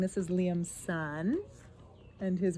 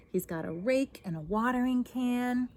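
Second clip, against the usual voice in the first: ordinary delivery, nothing marked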